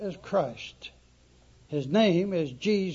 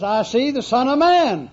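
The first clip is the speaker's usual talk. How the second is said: ringing proclamation; 6 semitones higher, 9 dB louder than usual. Retold or imitated. Stephen's vision, preached aloud.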